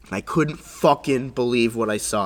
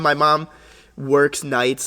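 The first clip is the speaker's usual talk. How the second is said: ordinary talk, nothing marked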